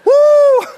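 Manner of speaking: loud, high "woo"; piercing falsetto holler; high whoop. Acted. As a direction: let out a comic whoop of relief. Whoop after reenacting the pain.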